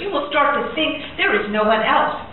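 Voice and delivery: usual speaking voice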